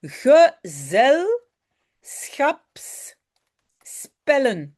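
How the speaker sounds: Gezelschapsspellen spelled out very slowly, syllable by syllable; slow model word. Shown as a demonstration. Teacher models Gezelschapsspellen for her student, very slow. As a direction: say Gezelschapsspellen slowly so the student hears each part. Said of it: "c'est un mot difficile".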